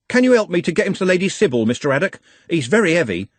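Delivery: fast, high, breathless pleading; desperate plea. Acted. Trev carrying Nut to the watchmen, desperate plea. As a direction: rush the request.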